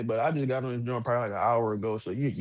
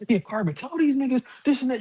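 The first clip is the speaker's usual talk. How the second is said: higher-pitched hyped caller shouting instructions; 10 semitones higher than usual. Retold or imitated. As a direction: play the rowdy guy in the background, pitched up. Caller voiced with background noise.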